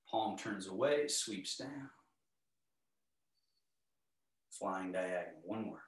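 calm, unhurried movement cues; serene tai chi cues. Acted. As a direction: guide the class gently through each move.

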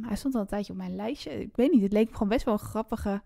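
ordinary talk

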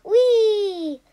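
high, loud joyful squeal; gleeful whee. Retold or imitated. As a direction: squeal like riding a balloon. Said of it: "he's like".

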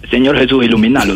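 low-pitched mock supplication; retold prayer. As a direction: pray low for a miracle. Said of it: "decía yo". (plegaria en voz grave)

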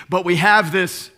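"But we have this" punched out loudly; emphatic scripture reading. Shown as a demonstration. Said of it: "I'm going to use different emphasis".

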